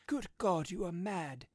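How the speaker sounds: high, loud horrified cry. A narrator's reading. Husband cries out in horror at wife. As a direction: recoil in horror as you say it. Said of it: "struck with horror".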